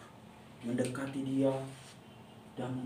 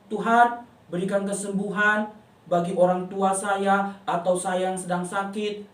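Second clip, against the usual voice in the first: put-on child's prayer. Shown as a demonstration. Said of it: "kita harus berdoa".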